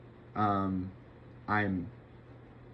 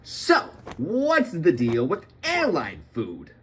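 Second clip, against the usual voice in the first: high, loud, drawn-out stand-up comic parody; hammed-up comedian opener, stretched and swooping; Jerry Seinfeld; 6 semitones higher, 4 dB louder, slower than usual. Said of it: "Jerry Seinfeld was on an airplane".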